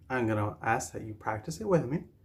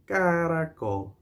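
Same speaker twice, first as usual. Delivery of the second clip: "Caracol" stretched out, higher; say-along model; 5 semitones higher, 3 dB louder than usual. Shown as a demonstration. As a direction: draw out "Caracol" so everyone joins in. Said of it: "Say it together with me".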